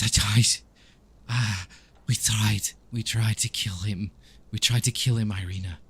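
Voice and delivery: loud, anguished confession breaking into fragments; tormented vampire character. Acted. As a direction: confess the failure in halting bursts. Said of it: "he says".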